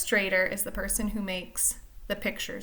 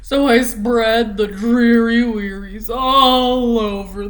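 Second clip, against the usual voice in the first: slow, louder, mopey singsong refrain; gloomy pout fish; 10 dB louder, slower than usual. Acted. Teacher reads the pout fish's gloomy refrain, slow and loud. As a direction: drag out every word like a sulking fish.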